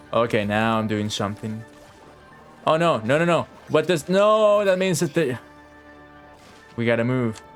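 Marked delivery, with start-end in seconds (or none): none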